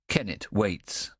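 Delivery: ordinary delivery, nothing marked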